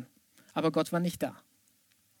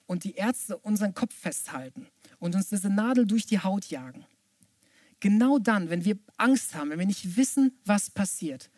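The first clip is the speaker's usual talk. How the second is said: ordinary talk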